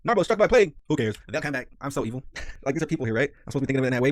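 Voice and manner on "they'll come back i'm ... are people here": usual speaking voice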